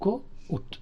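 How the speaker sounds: గో ఉట్ with a gap before "oot"; two-part demonstration of గో ఉట్; split diphthong. Shown as a demonstration. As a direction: separate o and u in గో ఉట్. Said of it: "అనాలి".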